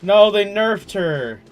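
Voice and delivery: loud, high, slow protest; loud drawn-out mock dismay; mock outrage. Real, not acted. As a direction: wail about the nerf. Gamer complaint.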